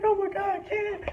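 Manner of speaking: hammed-up giddy wife losing it; mock excited wife. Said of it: "She's like".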